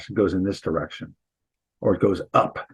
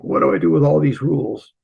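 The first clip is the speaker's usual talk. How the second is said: higher, mock-flustered question; overwhelmed student; 7 semitones higher, 3 dB louder, faster than usual. Teacher voicing students' thoughts about accent rules, mock overwhelmed. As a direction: play a student swamped by rules. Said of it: "and you'll think".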